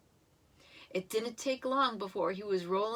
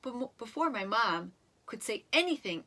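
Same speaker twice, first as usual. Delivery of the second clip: plain reading voice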